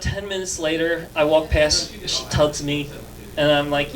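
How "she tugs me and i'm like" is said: usual speaking voice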